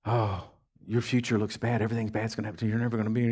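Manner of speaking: low, soft, creeping tempter; devil's discouraging voice. Acted. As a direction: play the devil quietly sowing doom. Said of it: "The devil comes to you and says".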